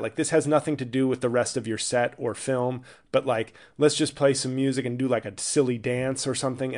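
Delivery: normal talking voice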